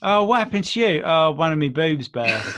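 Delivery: put-on playful Essex accent. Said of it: "went into an Essex accent there".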